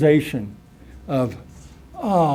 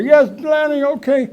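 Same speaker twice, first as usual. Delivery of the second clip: higher, sheepish yes; meek giving in; 9 semitones higher than usual. Retold or imitated. Rob giving in to Lenny, higher and sheepish. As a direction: give in like a scolded kid.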